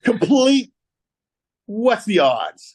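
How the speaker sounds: high, slow, drawn-out disbelief; incredulous. Real, not acted. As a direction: marvel loudly at an absurd coincidence. Disbelief at absurd coincidence.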